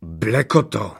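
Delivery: understated angry summons; mild enraged yell. A narrator's reading. Furious sorcerer calls the old devil, mild yell. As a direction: call your servant with a touch of fury. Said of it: "zaječel na starého čerta".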